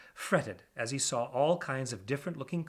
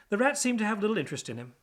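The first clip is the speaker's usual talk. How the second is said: ordinary delivery, nothing marked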